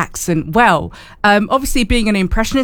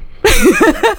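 burst of laughter; 10 semitones higher, 4 dB louder than usual. Real, not acted. Laughs after admitting no.

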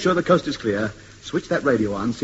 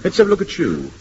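usual speaking voice

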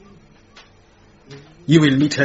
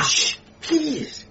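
hushed plea; 7 semitones higher, 5 dB quieter than usual. Acted. Man hushing the shouting maid.

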